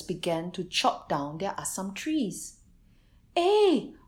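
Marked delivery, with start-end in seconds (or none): 3.3-3.9 high, loud, shocked cry; horrified shout. A narrator's reading. Visitor sees villagers chopping trees, horrified high shout. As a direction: shout in horror at the tree cutters. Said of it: "The Kampong Jelutong man was horrified".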